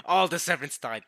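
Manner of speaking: laughing through the words. Real, not acted. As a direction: break up laughing while recalling it. Laughs at everyone dying in game.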